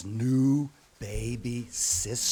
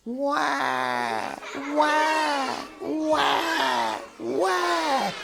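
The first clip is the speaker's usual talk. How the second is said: put-on high, louder wailing baby cry; 11 semitones higher, much slower than usual. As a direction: howl it like a baby in full cry. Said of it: "looked at the teacher and said".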